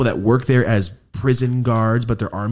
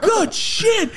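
elated; 3 dB louder than usual. Retold or imitated. His proud reaction, acted out.

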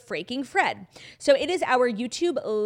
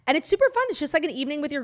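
excited pitch; 7 semitones higher, 3 dB louder, much faster than usual. Real, not acted. Hyping live show.

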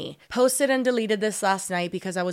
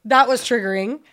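normal talking voice

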